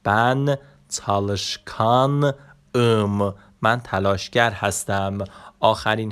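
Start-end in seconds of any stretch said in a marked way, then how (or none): 0.0-3.3 بن چالشکان ام stretched, ام after a pause; slow Turkish example. Shown as a demonstration. Teacher to students, sounds out Turkish slowly. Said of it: "شیشمان آخرین حرف صدادارش آ هست".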